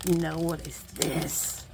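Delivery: ordinary talk, nothing marked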